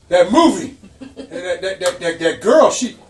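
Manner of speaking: hammed-up indignant Frank, cracking up; imitating Frank. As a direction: yell it like an offended friend. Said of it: "And he goes".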